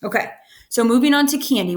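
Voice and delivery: usual speaking voice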